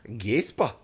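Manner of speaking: GASPA as "gays-pah", put-on Norse; mock Norse accent. Friend sounds out the Old Norse origin, mock Norse accent. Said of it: "doing all the accents".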